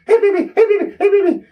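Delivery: very high squeaky imitation, loud; Jimmy Hart. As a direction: squeal it like a hyped manager. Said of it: "we had Jimmy Hart".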